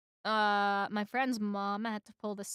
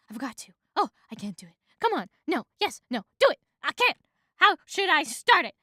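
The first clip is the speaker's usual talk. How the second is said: quick back-and-forth, pitch leaping; debating with himself; 5 semitones higher, 6 dB louder, faster than usual. A narrator's reading. Bee argues with himself over speaking, agitated. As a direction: argue both sides in short bursts. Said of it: "begins debating with himself".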